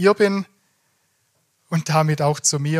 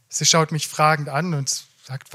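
ordinary talk

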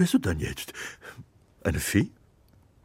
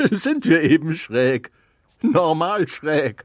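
cheerful; 2 semitones higher, 6 dB louder than usual. Acted. Laughing acceptance, loud.

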